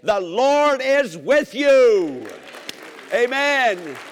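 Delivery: slow, high and loud, each word held out; fervent proclamation. Real, not acted. Preacher proclaiming to the congregation, slow and loud. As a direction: proclaim it with everything you have.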